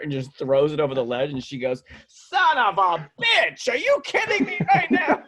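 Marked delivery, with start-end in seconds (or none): 2.1-5.2 high, loud mock shriek; outraged old lady. Old lady yelling at the yogurt thrower, outraged. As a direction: mimic her drawn-out outraged screech. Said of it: "there's this old lady sitting".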